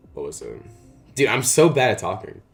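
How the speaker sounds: ordinary talk, nothing marked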